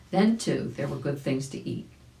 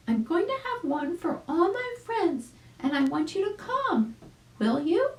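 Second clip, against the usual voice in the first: much higher, delighted chick voice; exclaiming chick. Acted. Arabella greeting Uncle Wiggily, high delighted chick voice. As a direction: squeal with joy at seeing him.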